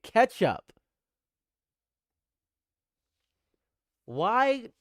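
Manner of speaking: high, loud and slowing despair; exasperated disbelief. Real, not acted. Streamer reacting to ketchup ice cream, high and exasperated. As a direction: wail it out, fed up.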